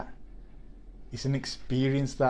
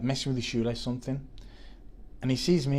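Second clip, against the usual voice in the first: ordinary talk, nothing marked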